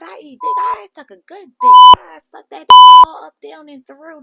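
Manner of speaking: screaming, very high boast; hammed-up shrieking brag; mock Nunu. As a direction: shriek the brag at the top of the lungs. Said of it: "And Nunu's like".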